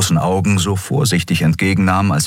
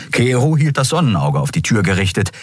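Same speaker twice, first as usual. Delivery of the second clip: plain reading voice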